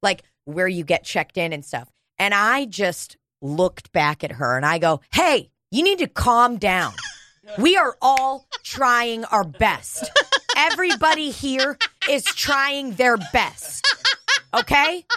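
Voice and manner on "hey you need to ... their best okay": slow, higher-pitched mock scolding; retold firm telling-off. Waiting patient calling out an agitated woman, retold scolding. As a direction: spell it out slowly, laying down the law.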